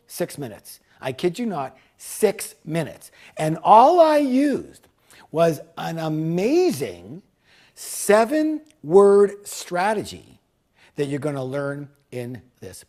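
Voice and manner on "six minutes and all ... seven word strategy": slow, punched delivery growing louder; emphatic build-up. Real, not acted. Speaker unveils his seven-word strategy to listeners, slow and emphatic. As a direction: draw out each key word for impact. Dramatic build-up to sales reveal.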